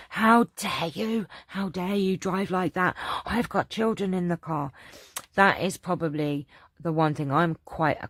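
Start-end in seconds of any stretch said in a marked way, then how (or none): 0.1-4.7 retold angry scream. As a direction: re-enact telling off a reckless driver, pitched up. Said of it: "I was just screaming at him".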